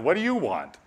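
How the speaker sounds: exaggerated blunt demand; brusque demand. Preacher showing how Jesus may not have sounded, brusque demand. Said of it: "I don't know that he said it quite like that".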